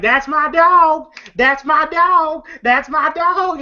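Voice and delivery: high, slow, drawn-out gleeful chant; Smokey, happy. Retold or imitated. Smokey celebrating Craig's knockout, imitated happy crowing. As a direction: crow it proudly, stretching each word. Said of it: "Smokey all happy".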